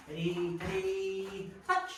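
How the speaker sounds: slow cue. Shown as a demonstration. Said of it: "calm cue for the touch".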